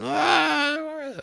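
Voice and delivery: a high mock crying wail; imitated wail. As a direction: mimic a woman bursting into a high wail. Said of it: "like this".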